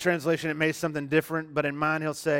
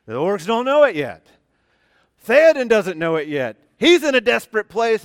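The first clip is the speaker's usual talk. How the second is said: louder, higher, rising excitement; building excitement; 6 semitones higher, 10 dB louder than usual. Retold or imitated. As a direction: swept up in the battle scene. Retelling the Helm's Deep climax.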